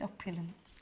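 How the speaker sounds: soft, low posh telephone voice. Shown as a demonstration. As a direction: say it with a plummy telephone voice. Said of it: "Your telephone voice".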